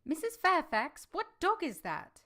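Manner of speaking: high, eager call across the hall; surprised call. Acted. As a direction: call out, curious about the dog. Jane calls out on arriving.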